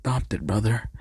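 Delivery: soft, hesitant correction; small, quiet, bashful voice; shy. A narrator's reading. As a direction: murmur it timidly. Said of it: "As Tamaki shyly saying".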